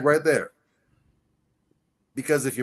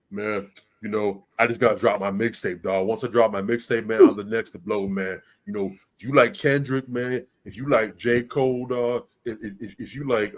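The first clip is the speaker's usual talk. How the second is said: low, loosely pitched wannabe rapper talk; lightly voiced mixtape hustler, pitched a bit low; would-be rapper; 5 semitones lower than usual. Aspiring rapper pitching his mixtape dream to a woman, hustler patter.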